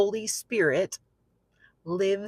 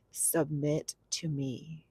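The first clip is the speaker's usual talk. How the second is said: slow, hushed, lower voice; quiet, unhurried command; gentle divine command; 4 semitones lower, 10 dB quieter, slower than usual. Retold or imitated. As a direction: speak softly and slowly as the Holy Spirit. Voicing Holy Spirit's command.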